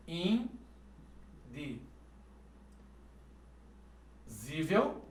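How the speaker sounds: long pondering repeat of indizível; drawn-out muttered repeat. Shown as a demonstration. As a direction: sound it out very slowly under your breath. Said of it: "repeats word drawn out".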